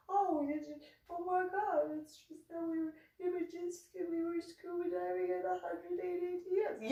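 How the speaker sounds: soft, high, tearful whine; mimicked crybaby blubbering; mock whining. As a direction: whimper the excuse in a tiny voice. Mimics partner's whining excuse.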